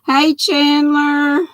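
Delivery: ordinary delivery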